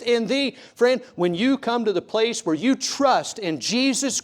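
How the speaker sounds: slow, rising, fervent preacher cry; preaching at full force. Real, not acted. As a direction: build to a passionate climax. Climactic preaching.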